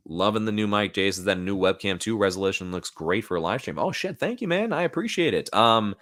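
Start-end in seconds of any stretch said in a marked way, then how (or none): 3.8-5.5 high-pitched, real gratitude; pleasantly surprised thanks. Real, not acted. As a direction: react to an unexpected compliment. Reacting to a viewer's compliment.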